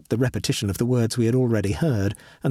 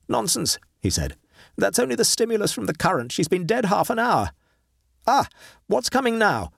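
raised, fast, brushing it off; Horton, dismissive; 8 semitones higher than usual. Acted. Horton dismisses his friend's fear, fast and raised. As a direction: wave away his fear, then lean in eager.

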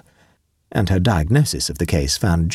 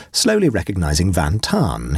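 ordinary delivery, nothing marked

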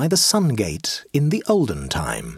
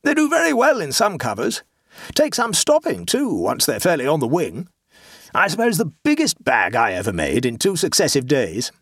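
higher, hearty club bore holding forth; pompous clubman; 5 semitones higher than usual. A narrator's reading. A club bore forcing racing talk on his neighbour, higher and hearty. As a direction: boom out the racing memories like a bore. Amblecope boasting of shooting.

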